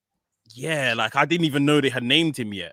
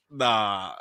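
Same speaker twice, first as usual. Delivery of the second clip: usual speaking voice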